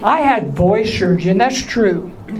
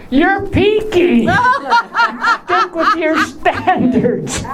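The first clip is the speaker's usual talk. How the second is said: slow mocking tease; 9 semitones higher, much slower than usual. Teasing audience member slowly.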